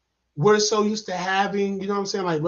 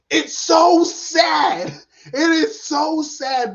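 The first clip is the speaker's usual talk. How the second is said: loud, high, drawn-out exclamation; emphatic lament; 7 semitones higher, 5 dB louder, much slower than usual. Real, not acted. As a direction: stretch it out, pitched up and loud. Reviewer stressing how sad it is.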